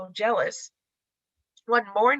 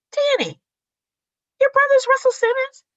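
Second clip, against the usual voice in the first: much higher and louder, squealing astonishment; shocked discovery; 8 dB louder than usual. Retold or imitated. Wife messaging Danny on learning his brother, shrieking shock. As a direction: shriek the question in disbelief.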